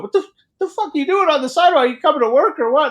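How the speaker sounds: high, loud, fast scolding; mock angry boss. Crew pusher waking a worker on the sidewalk, mock angry yelling. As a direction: imitate an incredulous boss rousing a worker.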